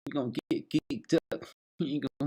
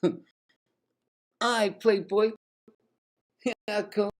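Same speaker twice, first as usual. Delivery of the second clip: higher, quick put-on voice; high voiced guest; 10 semitones higher than usual. Caller agreeing to come over, in a higher, lightly put-on voice. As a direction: rattle it off in a raised voice.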